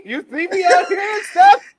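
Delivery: loud and high, playful yell; a playful high yell across the table; mock shout. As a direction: holler it at your co-host.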